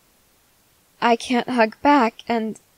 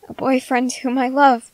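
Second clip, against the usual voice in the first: ordinary delivery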